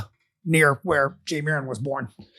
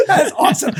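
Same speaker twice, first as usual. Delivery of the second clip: delighted; 13 semitones higher than usual. Real, not acted. Delighted after laughing.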